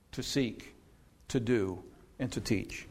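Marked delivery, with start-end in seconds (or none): none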